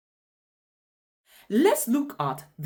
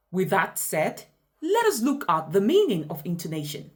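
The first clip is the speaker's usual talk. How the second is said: ordinary talk, nothing marked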